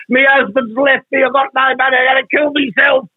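desperate caller. Retold or imitated. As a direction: mock-desperate woman ringing a radio advice show. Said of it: "the woman was desperate and said".

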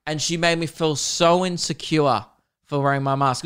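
ordinary delivery

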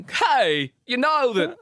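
Christopher Walken. Acted. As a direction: jump the pitch up on odd words. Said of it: "Christopher Walken, oh, it's not very good either".

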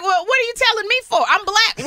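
high-pitched incredulous retort; acted-out affronted mom; indignant mother. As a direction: protest in comic disbelief. Mother's retort to son, retold.

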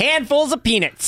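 "peanuts" said slow and high, carefully; showing off peanuts. Shown as a demonstration. Host proving he can say "peanuts", slow and high. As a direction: say "peanuts" slowly and correctly. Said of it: "You still can't say peanut".

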